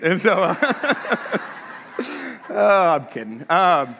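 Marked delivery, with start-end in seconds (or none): 0.6-2.4 laugh. Real, not acted. Laugh at own joke.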